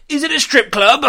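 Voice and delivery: high, loud mocking imitation of a tactless old prince; hammed-up posh prince asking cheekily. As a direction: send up his leering question. Mocking the prince's crude question.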